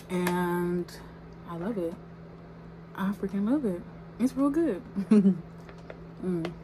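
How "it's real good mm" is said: normal talking voice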